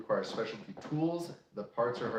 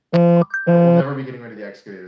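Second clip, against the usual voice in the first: emphatic burst; 3 semitones higher, 19 dB louder, faster than usual. Real, not acted. Firm declaration about the excavator.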